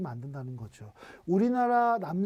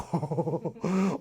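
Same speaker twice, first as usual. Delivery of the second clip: a short amused laugh. Real, not acted. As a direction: laugh at your own harsh joke. Laughs at own harsh line. (자기 말에 웃음이 터지는 웃음)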